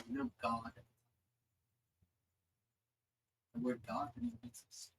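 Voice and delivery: lightly voiced celestial being, measured with long pauses; an understated goddess pondering mysteries, slowing on key phrases.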